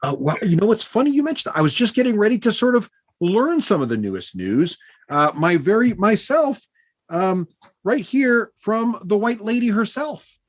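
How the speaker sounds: high-pitched, showy storyteller; self-important halfling bard. Acted. Bard boasting to new friends of his skill, high grand voice. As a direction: play the proud little bard, pitched high.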